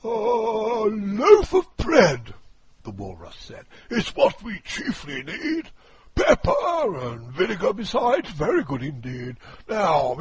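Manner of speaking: high, loud, booming walrus; a pompous walrus declaiming loudly. Acted. Walrus voiced.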